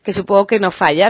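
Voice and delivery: normal talking voice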